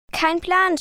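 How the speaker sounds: restrained yell of bewilderment; mild shouted reply. A narrator's reading. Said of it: "schrie Lori zurück".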